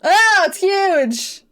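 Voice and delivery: high, loud, drawn-out surprise; startled exclamation. Real, not acted. Player seeing the pasted hat is huge, startled. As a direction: burst out slowly, voice jumping up. Sees the oversized hat image.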